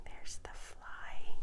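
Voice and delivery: slow, soft whisper; whispered aside. Real, not acted. Reader pointing out the fly to children, whispering slowly. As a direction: whisper it like a secret discovery.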